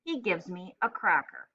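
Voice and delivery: ordinary delivery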